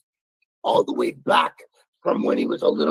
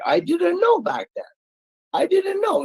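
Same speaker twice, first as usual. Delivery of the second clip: emotional outburst; 13 semitones higher than usual. Real, not acted. Telling Rick about not knowing back then, high and emotional. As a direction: voice shooting up high, pleading.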